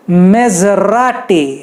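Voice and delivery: "Maserati" very slowly, with a zh sound; Italian Maserati. Shown as a demonstration. Teacher showing the Italian name, slow. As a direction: stretch "Maserati" with zh. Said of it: "झ होता है उसमें".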